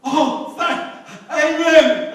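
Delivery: loud high gasping cry; frantic amen. Acted. Rider shouting the stop word at the cliff edge, panicked. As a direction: blurt it out at the last second.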